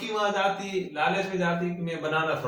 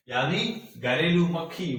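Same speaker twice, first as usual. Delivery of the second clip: usual speaking voice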